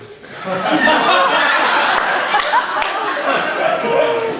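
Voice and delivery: long high wheezing laugh that rises; helpless loud laugh; breathless laugh. Real, not acted. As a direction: crack up completely. Laughs at his own insult.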